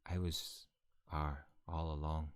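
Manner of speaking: understated, slow confession in character; low-key, drawn-out reveal; Rowan's reveal. Acted. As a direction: let the twist out slowly and quietly. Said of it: "No, he'd say".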